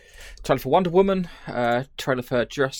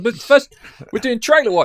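loud, high showman intro; excited announcement; 10 semitones higher, 8 dB louder than usual. Acted. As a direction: announce it big like a host. Announcing a segment.

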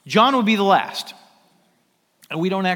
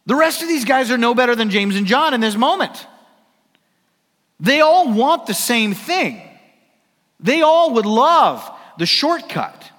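preaching at full force; 6 semitones higher, 6 dB louder than usual. Real, not acted. Preacher building to peak.

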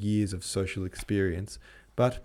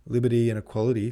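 sneering and quick, louder; contemptuous; 4 semitones higher, 6 dB louder, faster than usual. A narrator's reading. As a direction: spit it out with contempt. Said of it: "said the Viscount contemptuously".

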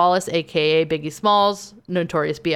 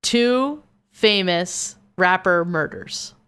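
slow, spaced-out words; slow, deliberate; much slower than usual. Real, not acted. Host rephrases for a confused co-host, slow and deliberate. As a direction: spell it out word by word. Rephrasing slowly after listener's request.

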